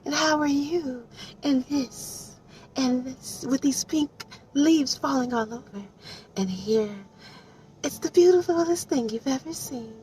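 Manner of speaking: high, soft swooning voice; dreamy gushing. Retold or imitated. Booktuber swooning over a romance scene.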